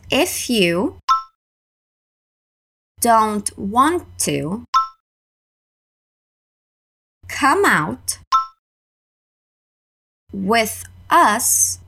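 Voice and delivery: each chunk said slowly and clearly for learners; slow, careful drill pace. Shown as a demonstration. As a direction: break it up slowly for the class to repeat. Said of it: "repeat her sentence in parts".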